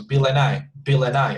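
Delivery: "Bill and I" run together like one long word; words linked as one. Shown as a demonstration. Teacher shows connected speech, "Bill and I" joined as one word. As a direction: link "Bill and I" smoothly into a single word. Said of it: "as if it were just one".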